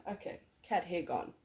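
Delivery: ordinary talk, nothing marked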